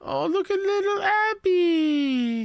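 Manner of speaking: very high, slow cooing at the puppy. Real, not acted. Host cooing at puppy Abby on the show, baby talk.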